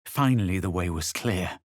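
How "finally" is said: usual speaking voice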